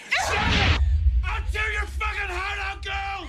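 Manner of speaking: high, full-force shout; furious threat. Acted. Intruder threatens the woman resisting him, furious shout. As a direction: explode into a screamed threat.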